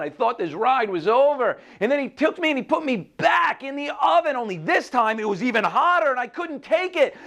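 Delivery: high, overwrought storytelling; distressed telling. A narrator's reading. Teacup put back in the oven, high distressed telling. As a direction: build up the ordeal in an anguished high voice.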